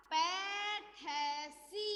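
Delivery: पैठसी drawn out very slowly; slow model word. Shown as a demonstration. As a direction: say पैठसी syllable by syllable. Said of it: "तो ये हो जाएगा".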